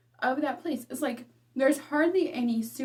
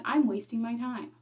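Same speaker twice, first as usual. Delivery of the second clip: usual speaking voice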